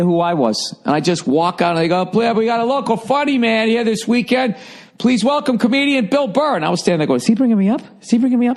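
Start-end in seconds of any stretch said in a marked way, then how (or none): 2.1-6.6 high, hammed-up emcee welcome; mock announcer intro. Political guy on stage announcing the comedian, in a high hammed-up emcee voice. 7.2-8.6 confused disbelief. Retold or imitated. Confused thought on stage.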